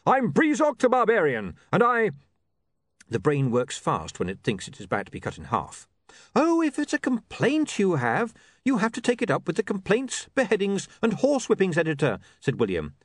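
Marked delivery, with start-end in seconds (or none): 0.1-2.1 loud, high proclamation; angry barbarian. Acted. Barbarian naming himself to the editor, angry. 6.3-12.2 higher, hurried-thinking official patter; nervous polite editor. Acted. Editor fobbing off an axe-wielding barbarian, nervously polite. William's figure voice, threatened.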